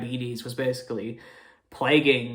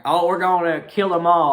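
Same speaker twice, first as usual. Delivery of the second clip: higher, louder mock villain; basic bad guy; 5 semitones higher, 8 dB louder than usual. Reviewer parodying a basic movie villain, loud hammed-up bad guy. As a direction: exaggerate a stock bad guy's threat. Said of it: "he's not your basic bad guy".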